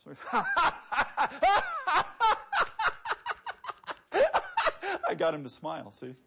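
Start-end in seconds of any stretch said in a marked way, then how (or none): 0.3-5.0 a loud high rolling laugh; infectious belly laugh, high and loud; contagious laugh. Shown as a demonstration. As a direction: laugh so the room joins in. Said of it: "it's contagious, isn't it".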